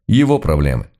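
plain reading voice

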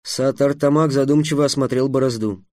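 ordinary delivery, nothing marked

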